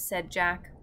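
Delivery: ordinary delivery, nothing marked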